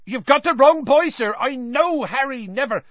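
very high, loud, agitated outburst; frantic protest, cut off mid-sentence; ranting Hagrid. A narrator's reading. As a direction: barrel on over the interruption. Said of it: "Hagrid went ranting on".

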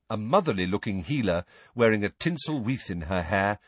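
ordinary delivery, nothing marked

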